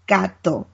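gato said GAH-toh with open Spanish a and crisp t; Spanish pronunciation. Shown as a demonstration. Teaching the hosts Spanish, modeling gato in Spanish. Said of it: "Sean, you try".

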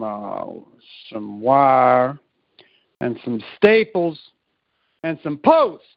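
slow farmer instructions that swell into a loud finish; soft building to loud. Retold or imitated. Farmer giving his worker a list, soft building to loud. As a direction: start low and slow, end loud. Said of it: "he'd go from soft to mid to just loud".

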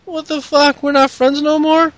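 high, hurt mock whine; Becky asking Charlotte in a high mock hurt voice. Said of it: "and Becky's like".

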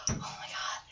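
put-on breathless little girl's whisper; awed whisper. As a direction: whisper it, starstruck. Little girl awestruck at cosplay.

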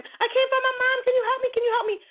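put-on high, pleading little girl voice. Retelling the TV show, the girl asks a man for help, high-pitched lost little girl plea. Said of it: "The little girl went up to him".

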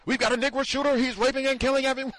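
high, loud, rushed fake emergency call; frantic shrieked report to police; mock panicked caller. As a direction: raise the alarm in hammed-up hysteria. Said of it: "the same person who called nine one one".

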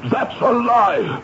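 high, slow, outraged; indignant denial. Acted. Accused man to Wicklow, slow outraged denial. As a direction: spit out the denial.

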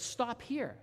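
put-on girl tells the man driving her to stop, high-pitched; little girl's request. Little girl asking the driver to pull over, high falling voice.

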